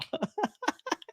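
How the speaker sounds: high, light laughter; amused laugh. Real, not acted. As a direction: laugh at your own confusion. Amused at his accent confusion.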